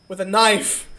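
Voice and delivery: usual speaking voice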